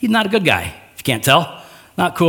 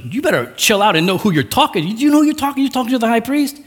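indignant scolding; 7 semitones higher, faster than usual. Retold or imitated. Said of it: "basically, like".

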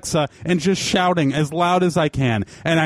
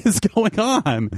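baffled; 5 semitones higher, slower than usual. Retold or imitated. Laughing disbelief at noise.

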